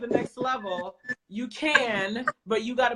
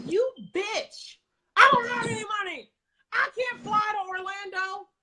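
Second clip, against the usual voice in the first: angry inner rant; 10 semitones higher than usual. Retold or imitated. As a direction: vent the rage at the coach, pitch up. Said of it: "Like, I'm just angry".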